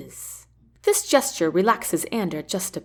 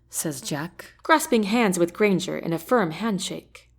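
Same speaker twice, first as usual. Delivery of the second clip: usual speaking voice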